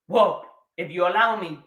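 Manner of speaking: ordinary talk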